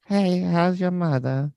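put-on high, slow old lady Spanish accent. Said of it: "This sounds like an old lady".

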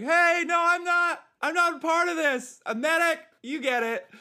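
acted-out yell pleading not to be shot. Medic yelling to a young Afghan fighter, loud high panicked shout. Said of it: "I'm yelling to a".

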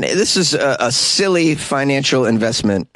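normal talking voice

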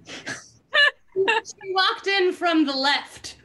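barely getting the words out laughing; breaking up laughing. Real, not acted. Reading the book to friends, breaking up laughing.

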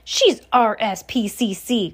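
understated cry of surprise; cried. A narrator's reading. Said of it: "cried Mister Jenkins".

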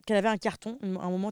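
ordinary delivery

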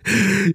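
short high burst of laughter. Real, not acted. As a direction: laugh out at his own joke. Laughs at his own line.

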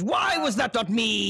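high, theatrical wail; mock-tragic outburst, pitched high; anguished lament. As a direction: cry out in hammed-up envy. Acted anguished outburst at Shrek.

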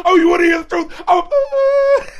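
hammed-up sobbing outburst, cracking high; mock crying shout.

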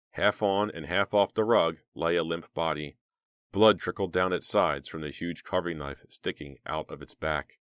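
plain reading voice

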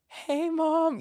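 very high, sugary hello; overcompensate, too sweet; overly sweet greeting. Retold or imitated.

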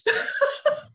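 high, airy burst of laughter; high breathless laugh. Real, not acted. Laughing at being called defended, high airy laugh.

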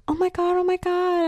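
high-pitched gasping horror; gasp, then squeal it in mock dismay; mock shock. Imitating friends' shocked grandparents, high mock shock.

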